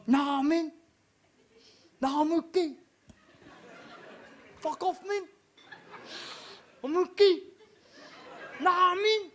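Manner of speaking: high-pitched Maori doorman; Maori accent. Acted. Big Maori doorman outside a nightclub, high Maori accent. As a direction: put on a high Maori lilt, refusing. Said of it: "you've got that Maori accent down pretty well".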